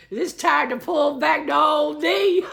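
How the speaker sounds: mock quote, high and loud through laughter; laughing quote. Reader voicing the playboy's thoughts, laughing. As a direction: giggle out the imagined line.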